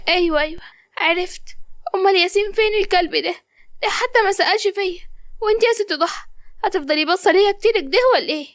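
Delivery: put-on high girlish voice, just waking, a little confused; a young woman coming round in hospital; waking patient. Qamar waking in hospital asks what happened, dazed and high.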